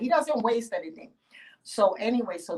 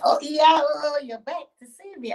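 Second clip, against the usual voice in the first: delighted farmer; 9 semitones higher, 5 dB louder than usual. Acted. Farmer greets returning Yemonja.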